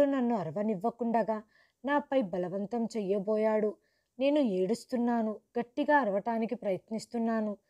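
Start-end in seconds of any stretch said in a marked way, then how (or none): none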